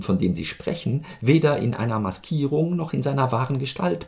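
höher, gekränkt und förmlich; Antwortbrief der Dame. Acted. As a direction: eine empörte Dame weist den Vorwurf zurück. Reads the lady's reply. (die Frau Stabsoffizier schreibt dem Major höher und gekränkt)

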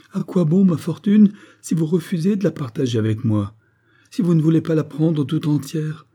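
high-pitched, impassioned reproach; distressed pleading. A narrator's reading. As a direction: plead with your husband not to leave. Wife pleads not to be left.

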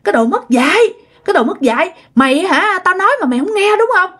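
high-pitched, acted drunken rant; angry cursing. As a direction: berate someone who will not budge. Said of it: "Ổng tức lắm, ổng nói".

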